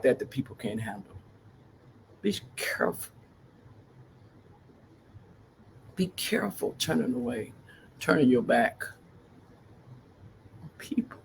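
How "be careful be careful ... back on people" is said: hushed warning. Real, not acted. Grave warning to viewers.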